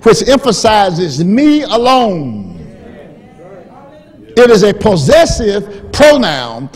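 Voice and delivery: loud, high, rising sermon delivery; full-force preaching. Acted. Preacher driving home the word my to the church, loud. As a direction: build the point to the rafters.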